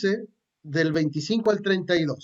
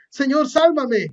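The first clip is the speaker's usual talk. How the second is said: higher, louder plea as he sinks; cry for help; 6 semitones higher, 5 dB louder, slower than usual. A narrator's reading. Pedro sinking in the water calls to Jesus, a higher, louder plea. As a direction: call out desperately while going under. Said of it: "empezó a hundirse y gritó".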